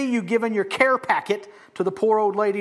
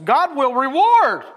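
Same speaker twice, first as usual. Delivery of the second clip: REWARD drawn out high and loud; slow build to a punched reward; stress on REWARD; 6 semitones higher, 8 dB louder than usual. Shown as a demonstration. Preacher shows each word's emphasis, stressing reward. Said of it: "do the emphasis on each word".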